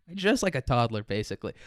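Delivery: normal talking voice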